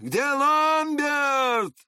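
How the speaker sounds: high, drawn-out holler for someone; wild cry from the street, long held vowels; wild shout. A narrator's reading. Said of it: "раздался дикий крик его с улицы".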